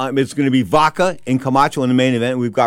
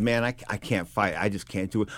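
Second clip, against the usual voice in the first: wasted refusal; 7 semitones lower, 11 dB quieter than usual. Retold or imitated. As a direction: give up in a low, feeble voice. Boxer refuses after another snort.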